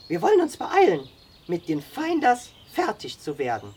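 high-pitched character voice; Sam, approving scout. A narrator's reading. Sam praising the boy and calling him to camp, approving. Sam's figure voiced in narration.

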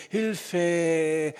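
"Hilfe" with a short first syllable and long-held Ä; "Hilfe" demonstrated, final vowel drawn out as Ä; long Ä ending. Shown as a demonstration. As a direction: stretch the end of "Hilfe" into a long Ä. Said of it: "Die erste Silbe klang kurz und quiekend, während die zweite wie ein langes Ä".

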